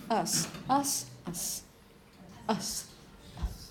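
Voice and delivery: weak, unstressed "us" dying away; schwa suffix. Shown as a demonstration. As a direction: repeat "us" ever weaker, vowel reduced to schwa. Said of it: "Whenever you find this suffix".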